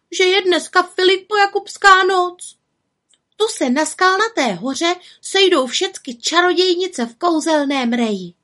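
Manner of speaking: high, plaintive character voice; aggrieved little witch. A narrator's reading. Little witch telling of Walpurgis Night, aggrieved complaint. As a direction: pour out the grievance, pitched high.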